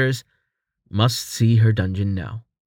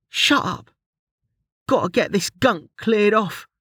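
sharp, higher-pitched snap; snapping; 10 semitones higher than usual. A narrator's reading. Ruli shutting down Quis's sarcasm, a sharp snap. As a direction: cut him off, impatient and sharp. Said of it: "Ruli snapped".

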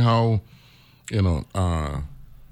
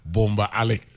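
Alec as AL-ick, stress on AL; first-syllable stress. Shown as a demonstration. Showing the teacher the right way, AL stressed in Alec. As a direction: model Boumba Alec with the AL accented. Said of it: "accent on the first, the first syllable".

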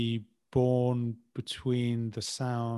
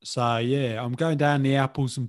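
loud, chummy Cockney patter; put-on Cockney accent, pitch falling at the end; 4 dB louder, slower than usual. Shown as a demonstration. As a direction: have a go at broad Cockney. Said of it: "can I have another go?".

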